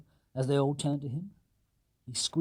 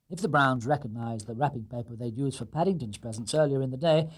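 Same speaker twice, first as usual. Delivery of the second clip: usual speaking voice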